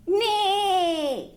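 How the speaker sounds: high, whinnying neigh; horse neigh. Shown as a demonstration. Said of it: "A horse".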